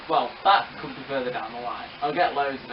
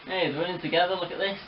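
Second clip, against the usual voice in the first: ordinary talk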